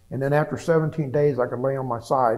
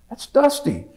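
astonished recognition; 12 semitones higher, 6 dB louder, slower than usual. Retold or imitated. Recognizing friend thought dead.

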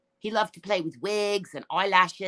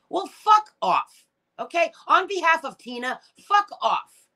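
angry fuck off; 8 semitones higher, 4 dB louder than usual. Real, not acted. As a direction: spit it out at her on Tina's behalf. Angry rant at Wild Angel.

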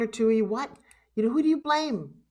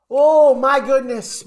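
overcome; 3 semitones higher, 8 dB louder, slower than usual. Real, not acted. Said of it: "apparently I'm in tears".

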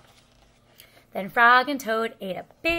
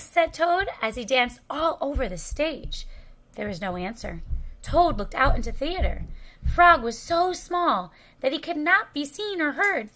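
ordinary delivery, nothing marked

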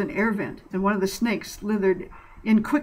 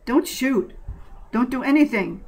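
higher, urgent order; 5 semitones higher than usual. Acted. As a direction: stop them before they fire. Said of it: "Kevin ordered".

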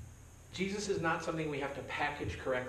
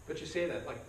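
ordinary talk, nothing marked

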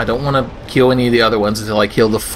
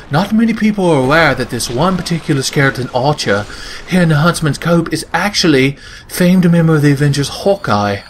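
mock British lore narrator; VaatiVidya as British. Lore explainer on a skeleton archer, mock British narration. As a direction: put on a measured British documentary voice. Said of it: "I don't think Vatty is actually British".

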